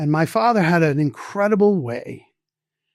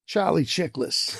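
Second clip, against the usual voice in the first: put-on soft, slow Boston accent; understated Boston accent; 6 dB quieter than usual. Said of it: "in a heavy Boston accent".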